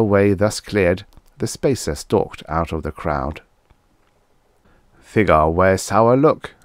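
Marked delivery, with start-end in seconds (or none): 5.0-6.5 slow, mumbled broken English; mumbling native. A narrator's reading. Pulf remarks on the chief at Guthrie's heels, mumbled. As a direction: mumble it slowly as a native follower. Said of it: "mumbled Pulf".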